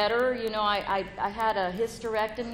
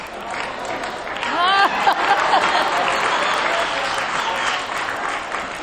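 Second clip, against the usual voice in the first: a long, high, louder laugh; 8 semitones higher, 6 dB louder than usual. Real, not acted. Recalling the proposal to the group, laughing long. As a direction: let the laugh roll on.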